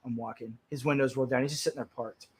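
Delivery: ordinary delivery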